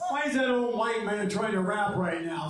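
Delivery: normal talking voice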